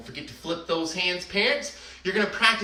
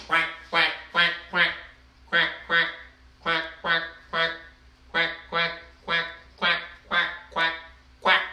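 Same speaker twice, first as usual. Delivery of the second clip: a mild quacking duck sound; 3 semitones lower than usual. Pretend. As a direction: quack along like a duck for the kids. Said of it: "way to quack like a duck".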